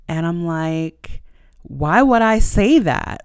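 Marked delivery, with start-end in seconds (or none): 1.6-3.2 high, loud and slow, indignant; indignant outburst. Retold or imitated. To her doubting best friend, a high loud slow indignant cry. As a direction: demand it back, stung and disbelieving.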